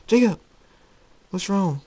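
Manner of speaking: high-pitched worried call; alarmed princess. Acted. Celestia running into Jacob's room, high and alarmed. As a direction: burst in calling out with concern.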